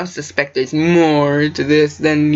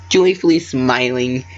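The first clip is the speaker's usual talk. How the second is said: ordinary delivery, nothing marked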